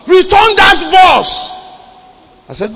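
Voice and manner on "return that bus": loud, high, drawn-out command; urgent shouted order. Retold or imitated. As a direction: shout the order slowly down the phone. Urgent order phoned to a pastor.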